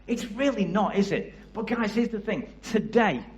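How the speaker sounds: ordinary talk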